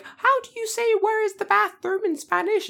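put-on very high squeaky kid voice; mock little kid. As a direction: pipe it up like a naive child. Voices a younger kid asking.

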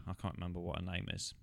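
usual speaking voice

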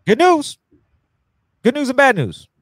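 high, loud mock-cheerful good news; nurse announcing news. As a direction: sing it out like a chirpy nurse.

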